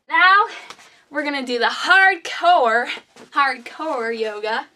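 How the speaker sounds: big, loud hype; excited announcement. Acted. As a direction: pump up the class. Announcing hardcore yoga.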